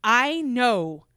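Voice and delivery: stare him down and drag out the truth; stern mom interrogation. Retold or imitated. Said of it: "I looked at him and I said".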